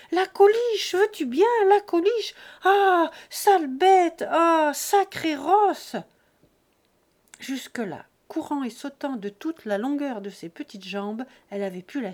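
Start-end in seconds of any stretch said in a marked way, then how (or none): 0.1-6.0 high, loud, slowed cries of rage and fright; angry and terrified. A narrator's reading. Girl being dragged, cursing her runaway cow in angry terror. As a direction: scream at the runaway cow half furious, half panicked. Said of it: "d'une voix de colère et d'épouvante".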